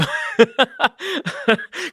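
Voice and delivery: a high, bright burst of laughter; laughing at a friend's sleepy reply. Real, not acted.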